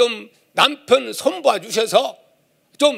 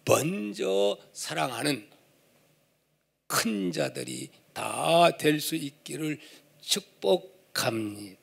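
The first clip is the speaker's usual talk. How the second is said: much softer closing benediction; soft blessing; 7 semitones lower, 10 dB quieter than usual. Real, not acted. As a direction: bless them quietly. (설교자가 조용히 축복하며)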